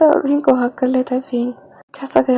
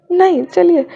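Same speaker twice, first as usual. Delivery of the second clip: loud, high, embarrassed no; flustered refusal; 6 semitones higher, 8 dB louder than usual. Acted. Wing turning down being lifted, flustered loud refusal. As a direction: blurt it out to dodge the offer.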